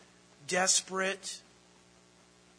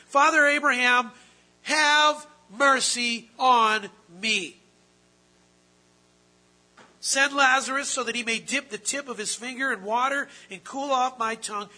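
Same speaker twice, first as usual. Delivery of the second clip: pleading; 5 semitones higher, 8 dB louder than usual. Retold or imitated. Preacher voicing the rich man in hell begging Abraham loudly. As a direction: beg from the flames for a drop of water. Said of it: "with Abraham and says".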